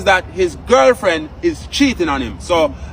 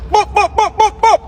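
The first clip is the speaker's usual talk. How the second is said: squeaky, much higher boop boop sounds; high-pitched boops; 13 semitones higher, 4 dB louder than usual. Acted. Greeting viewers in the intro, playful high-pitched boops. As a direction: chirp the boops up high like a toy.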